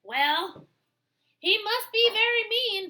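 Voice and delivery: high, loud worried guess; dog deciding. Acted. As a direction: declare it anxiously. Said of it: "Dog decided".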